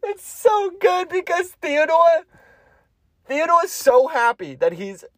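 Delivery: words squeezed through giggles; fighting back laughter; cracking up mid-sentence; laughing while talking. Real, not acted. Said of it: "I have to do this without laughing".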